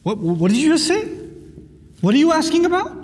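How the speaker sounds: incredulous, rising comeback; indignant challenge. Acted. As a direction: pitch climbing into a sharp question. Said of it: "when people make fun of what He's saying".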